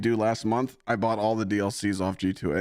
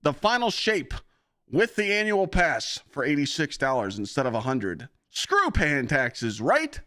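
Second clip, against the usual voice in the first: higher, bouncy pitchman voice; mock ad-read voice; 9 semitones higher, 4 dB louder than usual. Streamer hamming up a G2A sponsor read, higher pitchman voice. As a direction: hype it up like a cheesy commercial. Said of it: "I'm just talking like this".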